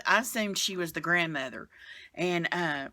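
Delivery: ordinary talk, nothing marked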